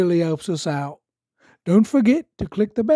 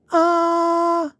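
Peppa saying ah; 13 semitones higher, 4 dB louder than usual. Acted. Peppa showing George.

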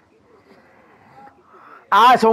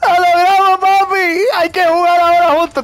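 high, elated celebrating yell; triumphant shouting; 9 semitones higher, 4 dB louder than usual. Real, not acted. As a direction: celebrate it loudly, voice pitched high. Celebrates meeting up in game. (gritando agudo de triunfo)

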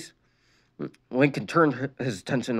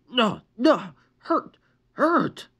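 angry yell; 11 semitones higher, much slower than usual. A narrator's reading. Injured boy yelling at his sister, angry. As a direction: shout the words in rage. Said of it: "Lincoln yelled".

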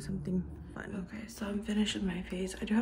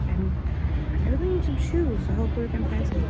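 muffled behind cloth mask; speaking masked in store; through a face mask; 9 dB louder than usual. Real, not acted. Seen 3: Speaker wears cloth mask over mouth and nose throughout clip.

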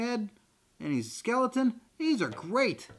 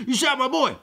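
louder, higher mock outrage; outraged father; 5 semitones higher, 6 dB louder than usual. Rick confronts his son's shooter, loud mock outrage. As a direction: accuse them as a hammed-up avenging dad.

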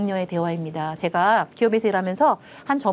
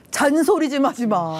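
slow, higher mock complaint; imitating husband; 4 semitones higher, slower than usual. Husband telling his wife to stop nagging, slow mock gripe. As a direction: drag it out like a fed-up husband. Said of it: "보통 뭐라고 말하죠".

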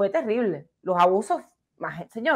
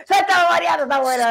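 riendo; 5 semitones higher, 4 dB louder than usual. Real, not acted. Repite entre risas.

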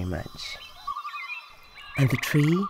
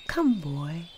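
ordinary delivery

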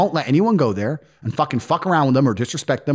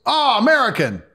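put-on raised, sing-song Eastern European accent; mock Moldovan accent; 8 semitones higher than usual. Moldovan man taunting American tourists at night, mock accent. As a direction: imitate the local guy's taunting broken English. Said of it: "And this guy goes".

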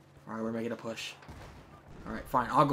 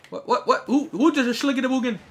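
high, stuttering bewilderment; flustered outburst; 10 semitones higher, 6 dB louder than usual. Real, not acted. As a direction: sputter loudly into nonsense. Flustered gibberish in a firefight.

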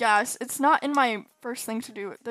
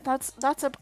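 normal talking voice

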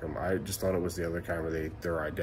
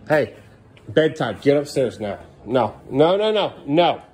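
angry yelling dad; 8 semitones higher, 11 dB louder than usual. Real, not acted. Furious dad ordering kids to bed.

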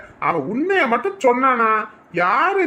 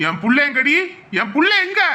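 angry demand; 2 semitones higher than usual. Acted. Father demands his child.

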